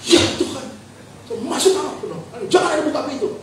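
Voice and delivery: high, softened acted alarm; retold frantic orders to children; mock panic. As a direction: pitch it up like a panicked protector. Acts himself panicking, ordering children.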